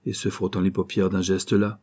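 ordinary delivery, nothing marked

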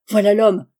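sudden loud exclamation; crying out. A narrator's reading. Watson to Holmes on spotting the cyclist, crying out. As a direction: call it out loudly, all at once. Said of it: "m'écriai-je".

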